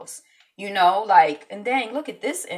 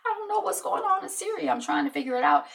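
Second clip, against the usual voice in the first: mock clueless voice; 11 semitones higher, faster than usual. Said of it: "Remember when I'm like".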